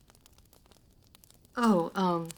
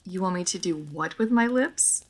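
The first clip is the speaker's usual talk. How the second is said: ordinary delivery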